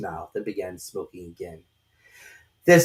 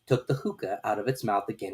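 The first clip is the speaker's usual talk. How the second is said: ordinary delivery, nothing marked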